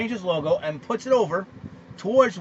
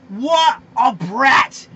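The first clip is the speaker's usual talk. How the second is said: slow, loud, drawn-out scorn; scornful loud outburst; 4 semitones higher, 10 dB louder, much slower than usual. Real, not acted. Host scolding LeVar Ball, loud and slow with scorn. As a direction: spit the insult out slowly.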